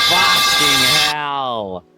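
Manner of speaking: loud, drawn-out startled yell; real fright, slow and loud; startled shout. Real, not acted. Startled by jump scare.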